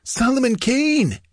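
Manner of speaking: gasped. Acted. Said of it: "gasped the other bandit".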